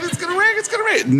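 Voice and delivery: very high, squeaky mock panic; acted frantic anticipation. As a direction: work yourself up in a tiny high voice. Acts waiting for the phone.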